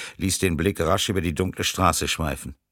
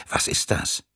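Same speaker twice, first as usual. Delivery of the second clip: hushed whispered question. A narrator's reading. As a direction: whisper it to the others. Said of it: "flüsterte Tyrin". (geflüsterte Frage)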